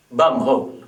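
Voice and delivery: usual speaking voice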